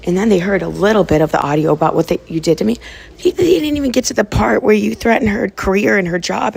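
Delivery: loud, heated recounting of wrongs; angry accusation. Real, not acted. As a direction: hammer out the grievance at full volume. Accusing someone of betrayal on stream.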